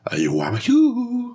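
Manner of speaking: very high, drawn-out goofy impression; Adam Sandler. Podcaster showing off a favourite actor impression, high goofy voice. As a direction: squeal it slowly like a comedian's silly character. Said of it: "I like doing Adam Sandler".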